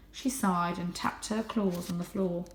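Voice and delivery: ordinary delivery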